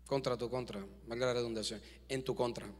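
soft low aside, almost to himself; muttered aside. Real, not acted. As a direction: drop the voice for a side remark. Aside repeating phrase, muted. (aparte en voz baja)

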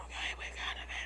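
hushed whisper, barely voiced. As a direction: whisper it so nobody else hears. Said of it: "así como que susurrando". (susurrando en secreto)

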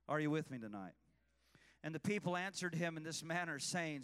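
ordinary talk

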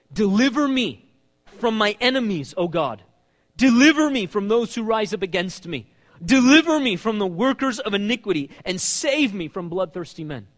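loud, high, impassioned scripture reading; preaching the psalm. Acted. Preacher reading David's plea aloud, full force. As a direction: pour the plea out to the congregation.

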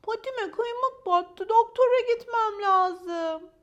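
high, loud, slow whining; plaintive complaint. Acted. Maya explains she needs a doctor, high plaintive complaint.

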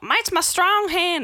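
slow, high mocking voice; mock gross person. Imitating someone grabbing cheese with a slow mocking voice.

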